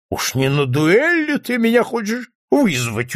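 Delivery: high, mocking drunken question; incredulous sneer, pitch soaring; scoffing smirk. A narrator's reading. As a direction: scoff at the very idea. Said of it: "усмехнулся тот".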